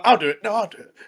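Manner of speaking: two boys bickering to outdo each other, played for laughs; squabbling kids. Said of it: "They're like".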